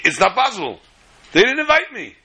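mild affronted protest, rising high at the end; indignant disbelief. Acted. Snubbed savior who was not invited, indignant. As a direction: protest the snub, pitch jumping up.

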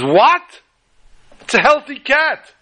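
high, loud startled cry of disbelief; shocked disbelief. Acted. Brother hearing his cat died, high shocked outcry. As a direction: jolt up in shock at the news.

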